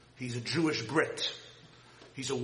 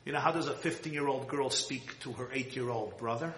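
usual speaking voice

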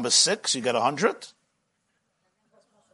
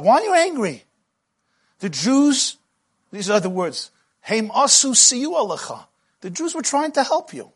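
pleading Moshe; 6 semitones higher than usual. Acted.

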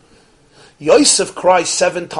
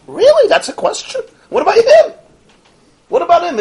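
much higher, incredulous, with a laugh; mock disbelief; 4 dB louder than usual. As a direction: scoff as if the question is absurd.

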